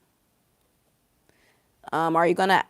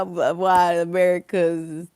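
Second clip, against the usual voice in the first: ordinary talk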